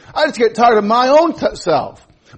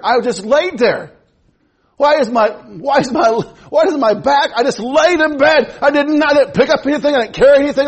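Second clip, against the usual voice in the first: higher, louder, exasperated griping about aching; mock complaining; 6 semitones higher, 4 dB louder than usual. Preacher mimics himself waking sore for the congregation, in an exasperated gripe. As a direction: ham up the baffled complaint about sore muscles. Preacher acting out morning aches.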